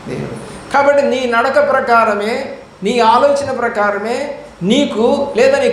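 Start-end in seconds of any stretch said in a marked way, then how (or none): 0.1-0.7 whispered answer. Acted. Preacher answers his own question.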